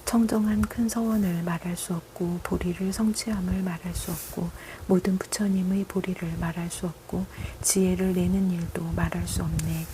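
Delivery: ordinary talk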